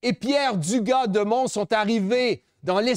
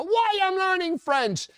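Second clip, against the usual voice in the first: higher mock-whiny student voice; imitating a student; 8 semitones higher than usual. Speaker imitating pupils in his school visits, higher whiny. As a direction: pitch it up like a pupil complaining. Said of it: "Combien d'élèves m'ont dit".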